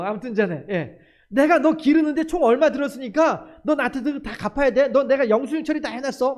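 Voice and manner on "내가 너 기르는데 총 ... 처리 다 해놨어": louder, higher, counting every won owed; mock demanding parent. Preacher mocks a parent demanding repayment, loudly. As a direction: play a parent billing the child, loud and indignant. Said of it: "이렇게 하는 부모 없단 말씀이죠".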